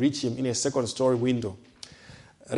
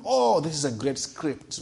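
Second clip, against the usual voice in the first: ordinary talk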